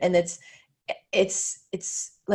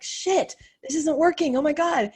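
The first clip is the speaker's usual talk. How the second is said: panicked; 8 semitones higher than usual. Retold or imitated. Panicking over bad launch numbers.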